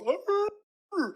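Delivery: high animal roar. Retold or imitated. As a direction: roar like a beast. Imitating Embiid's roar in nightmare.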